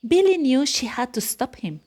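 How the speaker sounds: plain reading voice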